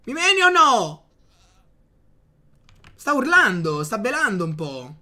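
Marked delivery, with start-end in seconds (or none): 0.0-1.0 high, loud taunt at the goat; taunting yell. Real, not acted. Player taunting a goat in Minecraft, high loud dare. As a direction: dare it to hit you.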